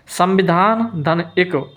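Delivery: ordinary delivery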